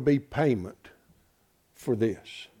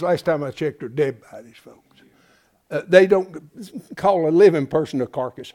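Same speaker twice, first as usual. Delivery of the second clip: ordinary talk, nothing marked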